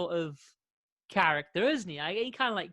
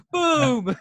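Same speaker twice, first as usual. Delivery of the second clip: mock explosive shout, higher and much louder; acted boom; 7 semitones higher, 10 dB louder than usual. Fan acting out producing his picture, loud playful boom. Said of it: "I'm like".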